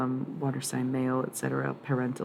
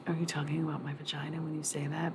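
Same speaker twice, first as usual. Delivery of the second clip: softer, offended voice; imitating an offended woman; 6 dB quieter, faster than usual. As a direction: act out someone taking offence. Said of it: "Definitely sounds like".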